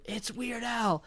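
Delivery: hushed, high imitation of a yell; small, held-back mock shout. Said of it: "who yelled".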